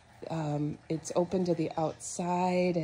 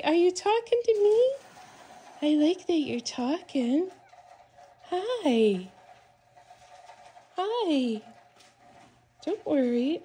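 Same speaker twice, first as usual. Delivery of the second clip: much higher, sing-song cooing to a kitten; 6 semitones higher than usual. Real, not acted. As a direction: coo up high to comfort a frightened kitten.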